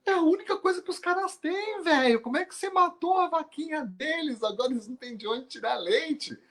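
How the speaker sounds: high, indignant squeaky voice; outraged grasshopper. Acted. Grasshopper protests to the monk that he killed the cow, high and indignant.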